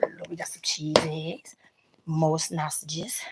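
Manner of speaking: soft unvoiced whisper. Real, not acted. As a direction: breathe the words, no voice. Whispering over the food.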